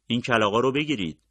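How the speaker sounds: wounded man shouting at them, loud; yelling. A narrator's reading. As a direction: call it out loud across the palm grove.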